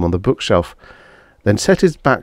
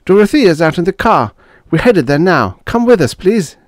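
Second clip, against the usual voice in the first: high, louder urgent appeal; begging; 12 semitones higher, 6 dB louder than usual. A narrator's reading. Young officer urges his neighbours to leave, begging. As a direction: press them to come along. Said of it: "begged the young officer".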